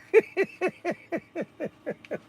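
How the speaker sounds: high laugh. Real, not acted. Laughing at his own impression.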